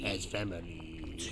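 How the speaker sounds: low, hushed, drawn-out villain imitation; mocking boss voice. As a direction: make fun of the boss with a slow, low, soft voice. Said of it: "making fun of the voice".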